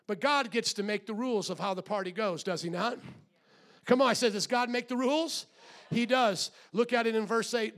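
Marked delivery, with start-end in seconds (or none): none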